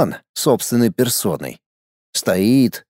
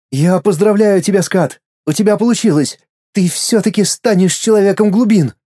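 put-on higher, brighter boy voice, glad; a boy cheering for his friend, pitched higher; boy's inner voice; 6 semitones higher than usual. Inner boy congratulating Skat, higher and happy. Голос внутреннего мальчика.

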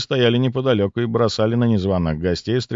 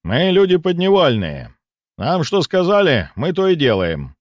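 mildly booming, higher-pitched reply; 6 semitones higher, 4 dB louder than usual. A narrator's reading. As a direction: answer with a bit of boom. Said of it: "ответил зычным голосом". (слегка зычным голосом)

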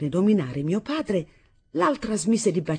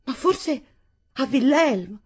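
high, thin, anxious question; thread of voice; 8 semitones higher, 3 dB louder, slower than usual. A narrator's reading. Daughter fearing for Wilhelm, in a thread of voice. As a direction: ask it in a small, high voice. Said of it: "con un filo di voce".

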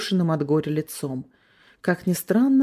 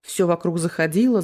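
plain reading voice

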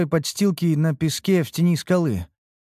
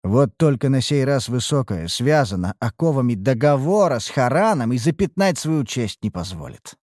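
ordinary delivery

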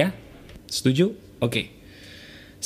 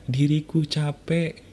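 guided affirmation; slower than usual. Acted. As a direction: let each phrase fall slowly, like surrendering. Leads a spoken self-apology.

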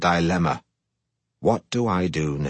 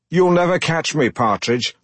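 put-on higher, taunting mid-Atlantic accent; 8 semitones higher, 3 dB louder than usual. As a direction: goad him in a hammy mid-Atlantic accent. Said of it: "his ridiculous mid-Atlantic accent".

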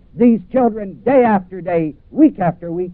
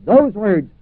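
ordinary talk

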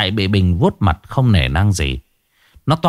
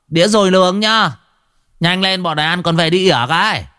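calling out; 8 semitones higher than usual. Acted. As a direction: shout across the eatery. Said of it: "gọi với".

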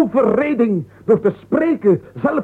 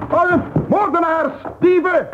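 higher, raised cry for help; alarmed outcry; 8 semitones higher than usual. Acted. Master shouting for help at the commotion. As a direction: call out murder and thieves.

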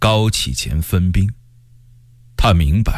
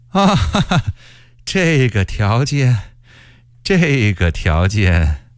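shrill laughing eunuch; slower than usual. A narrator's reading. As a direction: laugh shrilly, then stretch the words mockingly. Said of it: "这声音尖得像个女人一样".

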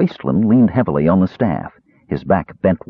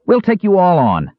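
bold challenge; 11 semitones higher, 3 dB louder than usual. A narrator's reading. Kender challenging soldiers.

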